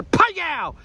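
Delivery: high, sharp ricochet noise; mouth-made ricochet. Pretend. Witness imitating bullet off Trump's ear, mouth-made ricochet.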